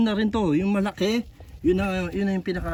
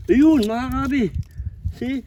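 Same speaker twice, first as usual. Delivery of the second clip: excited; 5 semitones higher, 6 dB louder than usual. Real, not acted. Spotting a big catch.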